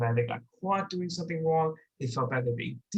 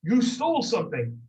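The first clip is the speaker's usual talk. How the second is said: accusing call-out; 7 semitones higher, 5 dB louder than usual. Retold or imitated. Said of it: "he could say".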